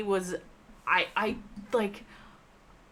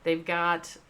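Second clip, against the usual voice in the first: normal talking voice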